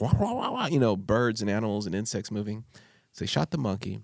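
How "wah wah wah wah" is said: high mock wah-wah babble, much higher pitch; babble of jungle noise. High wah-wah sounds imitating gibberish. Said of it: "it just sounds like".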